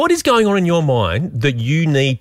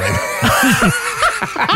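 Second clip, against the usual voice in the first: burst of laughter; 10 semitones higher than usual. Real, not acted. Laughing at the joke.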